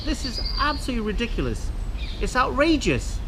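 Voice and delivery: high, fast, exasperated; indignant rant. Real, not acted. Ranting to viewers about litter, high and exasperated. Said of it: "Sorry about the rant".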